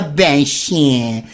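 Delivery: normal talking voice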